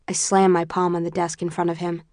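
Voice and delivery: plain reading voice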